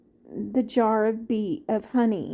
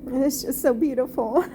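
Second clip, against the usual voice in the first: usual speaking voice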